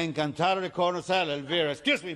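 put-on exasperated, loud, high American-accented Spanish; fed up. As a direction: rattle off the phrase angrily in an American accent. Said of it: "George está encabronado".

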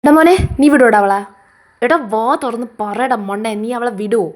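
loud, pushing demand. Acted. Mother demanding her son leave his wife, loud. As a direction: press the son loudly for an answer.

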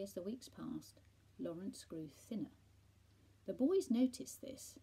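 ordinary delivery, nothing marked

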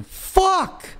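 high-pitched real outburst; frustrated yell. Real, not acted. Streamer cursing his own mistake, high frustrated yell. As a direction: let it fly in frustration.

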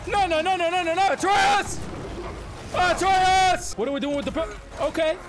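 ordinary delivery

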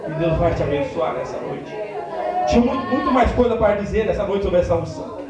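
fiery preaching. Acted. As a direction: preach it to the church at full force. Preaching climax.

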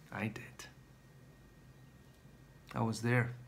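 hushed, almost no voice; breathless whisper. Acted. Finally reaching his enemy.

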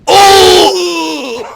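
high, loud drawn-out howl; long excited scream. Real, not acted. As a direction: let out a long piercing "oo". Oyuncular düşünce tepki. (uzun, tiz sevinç çığlığı)

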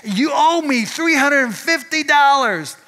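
high, slow mock screaming of an angry customer. Preacher mimics the customer dragged out, high mock scream. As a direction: wail the demand like the lady carried out. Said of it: "screaming and yelling".

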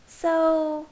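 high, hesitant woman's voice; said slowly. A narrator's reading. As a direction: draw it out a touch, in a high voice. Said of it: "Alice said slowly".

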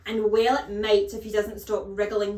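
loud, callous headmistress snort; snorted scorn. A narrator's reading. Headmistress brushing off teacher's plea, snorting. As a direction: dismiss the plea loudly. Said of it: "snorted the Trunchbull".

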